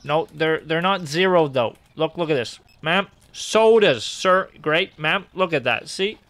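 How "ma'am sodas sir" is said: louder, higher, slowed shout to shoppers; hawker call. Acted. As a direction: pitch it like a street vendor. Advertising sodas to shoppers.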